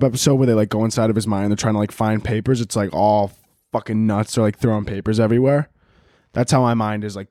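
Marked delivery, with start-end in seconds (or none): none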